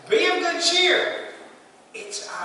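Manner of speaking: high, slow call to the disciples; lightly voiced calm Jesus; Jesus reassuring. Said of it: "what does he say".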